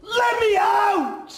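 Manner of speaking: very high, loud, slow yell; desperate shout. Acted. Boy trapped inside, screaming to be let out. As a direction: drag out each word in a scream.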